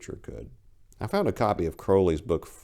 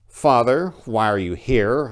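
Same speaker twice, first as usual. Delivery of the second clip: louder, curt demand at the door; brusque question; 6 semitones higher, 8 dB louder, slower than usual. Acted. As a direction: snap the question at the unwanted priest. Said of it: "I asked brusquely".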